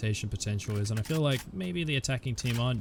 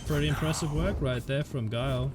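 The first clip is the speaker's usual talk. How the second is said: esports caster. Real, not acted. Live play-by-play commentary.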